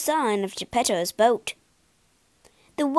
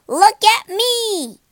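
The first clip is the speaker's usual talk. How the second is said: high, loud, slow show-off call; shouting; 10 semitones higher, 8 dB louder, slower than usual. Acted. Pinocchio bounces on a trampoline, loud high shout. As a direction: yell it while bouncing sky-high. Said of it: "he shouted".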